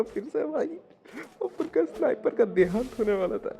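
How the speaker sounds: high-pitched mock lament; mock alarm. Mock alarm, sniper nearly dying. (ऊँची आवाज़ में नकली घबराहट)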